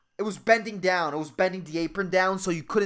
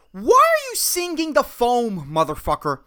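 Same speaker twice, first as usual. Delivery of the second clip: exasperated outburst; 3 semitones higher than usual. Acted. Barista fuming at singing customer, exasperated.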